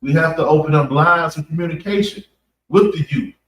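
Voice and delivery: usual speaking voice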